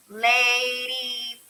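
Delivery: "Ladybug" drawn out slowly in three beats; "Ladybug" in long, separated chunks; syllable by syllable. Shown as a demonstration. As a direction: stretch each syllable of "Ladybug" for counting. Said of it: "Try it this way".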